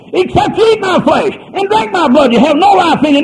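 quoting Jesus at a high, loud pitch; high-pitched preached quotation; preached quote. Acted. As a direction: ring out the words of Jesus. Quoting Jesus at full force.

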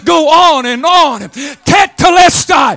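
whooping preacher's crescendo; preaching at full force. Acted. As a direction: shout the victory to the congregation.

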